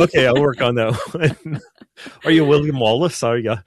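giggling through a hammed-up Scottish accent; mock Scottish brogue breaking into laughter; laughing Scottish accent. Priest on the radio laughing through a fake Scottish accent. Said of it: "I won't try a Scottish accent anymore".

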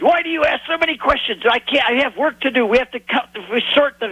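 put-on high, broken English with a thick Hebrew accent. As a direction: imitate the Israeli fisherman's heavy Hebrew accent. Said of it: "in very thick Hebrew accent".

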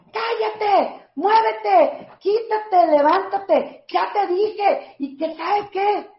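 high-pitched, sharp string of orders; mock scolding mother. Child quoting mother's morning orders, high mock scolding. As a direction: imitate a mother nagging from the moment she's up.

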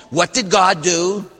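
usual speaking voice

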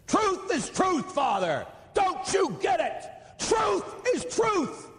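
high, slow, shouted mock caller; hammed-up shout of an angry caller; imitated caller shouting. Caller insisting to the priest, high mock shout. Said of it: "a man gets on and he says".

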